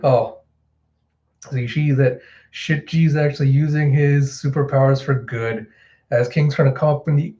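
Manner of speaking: normal talking voice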